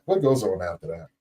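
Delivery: low, settled echo of the question. Real, not acted.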